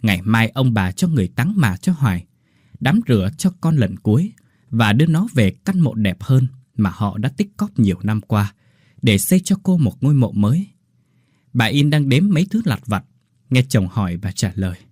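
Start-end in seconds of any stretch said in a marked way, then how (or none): none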